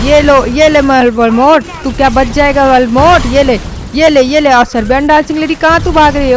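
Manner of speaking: loud, fast, pumped-up yelling at enemies; battle shout. Acted. Franklin storms in and yells at villains, loud battle shout. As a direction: roar it mid-gunfight.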